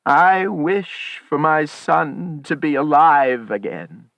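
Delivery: loud, slow, high declaration; solemn wish. A narrator's reading. Old man wishes his dead son alive, solemn declaration. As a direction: proclaim the wish slowly and loudly. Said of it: "He raised his hand and said".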